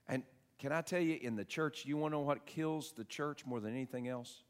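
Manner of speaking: ordinary talk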